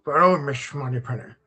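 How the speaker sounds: low, slurred Scottish Connery voice; Sean Connery impression. Streamer shows off another voice, a low Sean Connery. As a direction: purr the name like Connery playing Bond. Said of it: "It's like Sean Connery as well".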